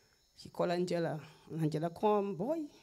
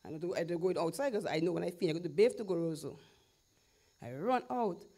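normal talking voice

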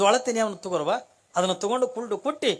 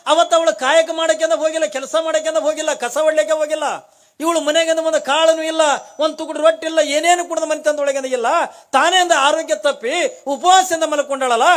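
higher, louder sermon voice, pressing each phrase; impassioned preaching; 8 semitones higher, 7 dB louder than usual. Acted. Storyteller to devotees on the saint's arrival, impassioned preaching. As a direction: preach it out to the hall with fervour.

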